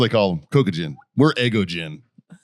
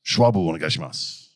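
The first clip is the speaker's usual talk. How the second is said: Japanese phrase; 8 semitones lower than usual. Retold or imitated. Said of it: "And I'd go".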